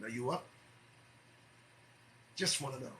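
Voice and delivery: ordinary talk, nothing marked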